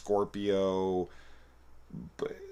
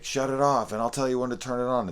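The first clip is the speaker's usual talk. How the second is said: higher, louder, fast command; barked order; 8 semitones higher, 5 dB louder, faster than usual. Retold or imitated. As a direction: bark the order and rush on. Barking orders during fight.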